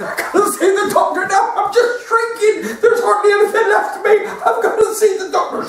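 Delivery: high squeaky panicking voice; panicky shrinking man. Retold or imitated. Shrinking patient begging the nurse, high squeak. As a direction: squeal like a man getting smaller.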